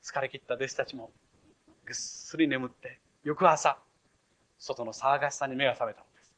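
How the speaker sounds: normal talking voice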